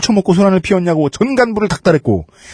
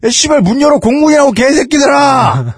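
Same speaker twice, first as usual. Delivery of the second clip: put-on drunk yelling; 10 semitones higher than usual. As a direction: scream at the guards, drunk and furious. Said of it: "윤 상사".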